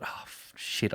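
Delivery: hushed inner swear; a whispered oh shit to himself; whispered curse. Retold or imitated. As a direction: breathe it out like a guilty thought. Inner thought of a guilty brother.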